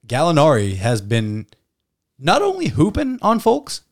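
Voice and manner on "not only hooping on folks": excited. Real, not acted. Hyped praise of Gallinari.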